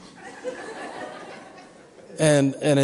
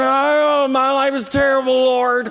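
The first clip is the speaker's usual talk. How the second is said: whiny lament; 8 semitones higher than usual. Retold or imitated. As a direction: moan it out to God. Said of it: "we start going".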